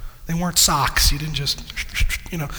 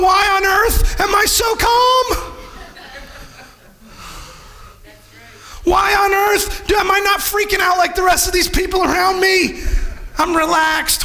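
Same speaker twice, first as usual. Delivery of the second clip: amazed calm believer; 11 semitones higher than usual. Pretend. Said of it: "and you're going".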